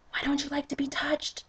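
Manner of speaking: soft, high whispered question. Acted. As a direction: breathe the question, hushed. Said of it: "I whisper".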